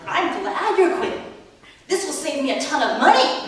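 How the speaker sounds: loud, laughing mock spite; hammed-up sarcastic mom; sarcastic yelling. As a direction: snap back with fake relief. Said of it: "on the verge of yelling back at him".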